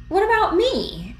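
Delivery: high, loud indignant protest; object, feeling left out. Acted.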